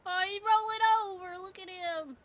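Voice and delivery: real delight at the pet cat; delighted squeal. Real, not acted. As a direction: coo over the cat rolling over. Cat rolls over in game.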